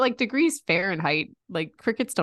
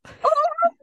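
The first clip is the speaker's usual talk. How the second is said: high squeal laugh; 5 dB louder than usual. Real, not acted. Laughing at turkey joke.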